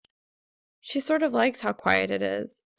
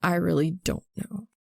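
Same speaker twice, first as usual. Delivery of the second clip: gritted teeth; 3 semitones lower than usual. A narrator's reading. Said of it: "he grits out".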